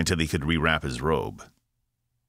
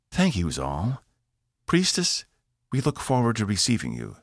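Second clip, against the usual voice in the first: higher, politely asking captain; 7 semitones higher than usual. A narrator's reading. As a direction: ask for the floor carefully. Evie voiced in story.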